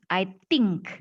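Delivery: "think" said as "tink" after "I". Shown as a demonstration. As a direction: mimic the learners' hard t in "think". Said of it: "biasanya Anda bacanya tuh". ("think" diucapkan dengan bunyi t)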